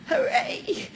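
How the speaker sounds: high, drawn-out playful hooray; mock cheer. Lecturer jokes about being a native English speaker, mock cheer. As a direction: cheer it with a laugh in the voice.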